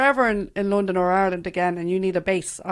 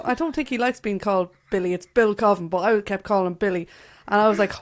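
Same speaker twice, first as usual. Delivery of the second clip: usual speaking voice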